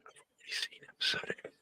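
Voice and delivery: secretive whisper; hushed confession, unvoiced. Real, not acted. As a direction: whisper it so she can't hear. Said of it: "don't tell my girlfriend".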